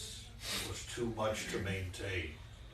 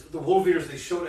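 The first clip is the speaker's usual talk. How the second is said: a touch of excitement; 5 semitones higher, 9 dB louder than usual. Acted.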